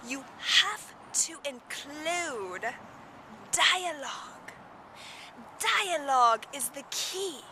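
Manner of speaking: a dramatic British speaker drawing the words out; Patricia Fripp British accent. Retold or imitated. Imitated speaker lecturing storytellers in a theatrical British accent. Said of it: "I spoke in a British accent".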